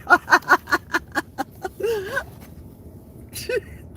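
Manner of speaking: long airy giggle, rising high; a long wheezing giggle up high; high breathy laugh. Real, not acted. Laughs at own joke.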